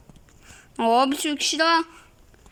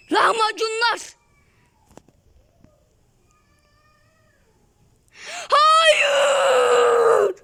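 building from louder no's to a very high, loud wailing cry; despairing cry; 9 semitones higher, 5 dB louder, much slower than usual. Real, not acted. Gamer losing the final round, building to a wailing cry. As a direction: wail the no's, rising until the voice breaks.

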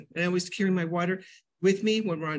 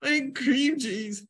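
slow, higher whimpering admission; pitiful confession; 6 semitones higher, slower than usual. Retold or imitated. Answering husband, slow high pitiful confession. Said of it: "And I'm like".

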